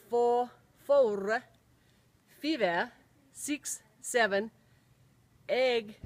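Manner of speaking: slow Finnish-accented counting, every letter sounded. Shown as a demonstration. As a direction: count in English as a Finn reading phonetically. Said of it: "in English with a Finnish accent".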